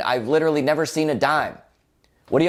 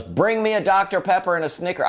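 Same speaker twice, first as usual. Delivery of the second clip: mock yell; 7 semitones higher than usual. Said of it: "And he yells".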